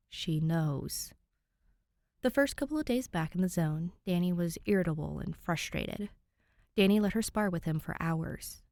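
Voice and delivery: steady, even fanfic read-aloud; calm storyteller voice; story narration. A narrator's reading. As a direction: read it like an audiobook chapter. Reads fanfic chapter aloud.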